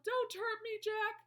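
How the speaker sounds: high, loud begging; pleading yell. A narrator's reading. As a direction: yell for mercy. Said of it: "he yelled".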